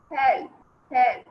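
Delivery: loud cry for help; loud trapped call for help. Acted. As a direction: shout help from under the rock. Trapped snake calls for help.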